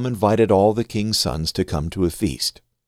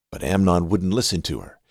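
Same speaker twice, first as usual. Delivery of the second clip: ordinary delivery, nothing marked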